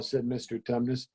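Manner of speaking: plain reading voice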